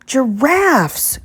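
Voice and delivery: high startled cry; surprised cry. Acted. Guest finds giraffes on ninth floor, startled cry. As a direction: blurt it out, caught off guard. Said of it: "cried Mister Snore".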